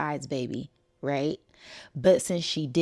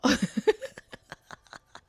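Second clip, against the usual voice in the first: high giggling laugh; high amused giggle; 10 semitones higher than usual. Real, not acted. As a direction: laugh at the man faking a fall. Laughing at the faker.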